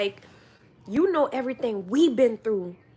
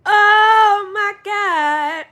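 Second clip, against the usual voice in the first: much louder, higher exclamation; loud oh my God; 9 semitones higher, 12 dB louder, much slower than usual. Real, not acted. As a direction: shout it out in disbelief. Reacting loudly, laughing.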